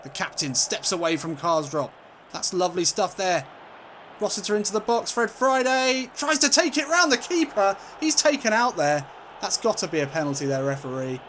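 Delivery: commentary rising high and loud; excited play-by-play. Real, not acted. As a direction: build with the attack towards the shot. Commentating an attack.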